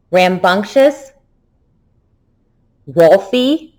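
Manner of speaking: one word at a time with long pauses; teacher modelling single words. Shown as a demonstration. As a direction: give each word alone for the class to echo. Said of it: "repeat the word after me".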